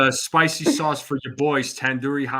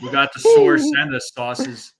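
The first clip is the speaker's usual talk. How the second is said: high, loud and slow; hammy punchline; 12 semitones higher, 8 dB louder, slower than usual. Pretend. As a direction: ham up the pun. Punchline wordplay, hammed up.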